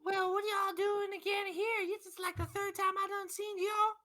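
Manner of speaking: put-on high, loud grumpy Southern old man; cranky old shopkeeper. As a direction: bark at the adventurers like a fed-up old codger.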